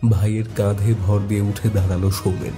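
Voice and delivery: normal talking voice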